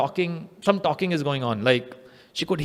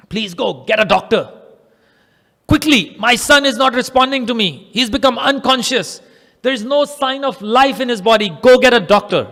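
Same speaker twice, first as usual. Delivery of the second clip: high-pitched panicked mother; acted frantic alarm; urgent plea; 6 semitones higher, 4 dB louder than usual. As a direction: beg for help, growing more insistent. Mother begs husband for a doctor.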